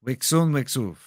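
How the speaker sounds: put-on Japanese-accented "Wexon Wexuf" in a changed timbre; the Miyagi "Wexon Wexuf" in a different voice. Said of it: "Do Mister Miyagi?".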